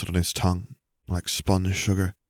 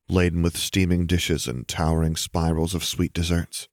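usual speaking voice

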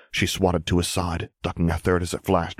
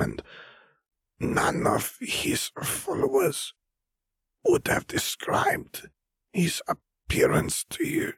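very slow, higher, rising to an eerie whisper; slow eerie old wizard, whispering much higher; voice of Fer; 9 semitones higher, 3 dB quieter, slower than usual. Acted. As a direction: draw it out, then breathe the end as a whisper. Fer speaks slowly, whispering.

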